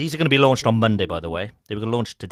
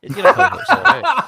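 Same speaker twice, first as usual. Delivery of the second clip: laughing; 11 dB louder than usual. Real, not acted. Host jokes, laughing.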